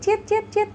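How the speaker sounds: very high squeaky chirps; mouse squeak imitation. Shown as a demonstration. Teacher imitates a little mouse for students, very high squeaks. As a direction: squeak like a tiny quick mouse. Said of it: "bunyinya cit".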